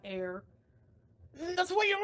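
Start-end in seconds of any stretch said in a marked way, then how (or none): 1.6-2.0 high, loud mocking voice of him; mocking caricature of the ex; impression of him. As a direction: squeak it out like a silly caricature of him. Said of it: "This is my impression of him from now on".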